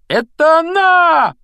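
very high, loud, drawn-out shriek; sudden yell. A narrator's reading. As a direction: scream it out in amazed triumph. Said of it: "неожиданно завопил Рон".

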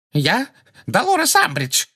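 very high, squeaky frightened voice; terrified squeal. A narrator's reading. Umbridge answers the centaur, terrified squeal. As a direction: squeal your name in fear. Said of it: "ответила Амбридж визгливым, насмерть перепуганным голосом".

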